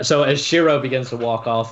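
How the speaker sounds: ordinary talk, nothing marked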